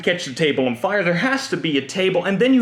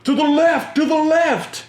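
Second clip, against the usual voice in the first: high, loud commanding call; teacher shouting orders; 10 semitones higher, 5 dB louder than usual. Retold or imitated. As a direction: direct the charge like a drill leader. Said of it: "The math teacher would be like".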